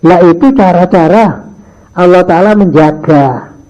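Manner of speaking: ordinary talk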